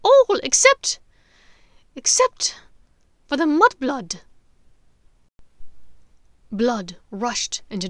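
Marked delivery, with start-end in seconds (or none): 0.0-4.2 high, loud lilting taunt; gloating witch. Acted. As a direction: savour picking her out. Bellatrix singling out.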